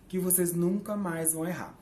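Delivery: ordinary talk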